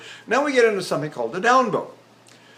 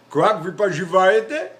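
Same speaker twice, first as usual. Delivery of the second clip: placed foreign phrases; 3 semitones higher, 4 dB louder than usual. Shown as a demonstration. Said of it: "You can speak any language there".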